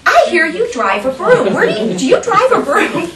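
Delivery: high, loud imitation of first graders; curious kids. First graders questioning teacher after field trip, imitated high. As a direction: ask it like eager children, pitched well up.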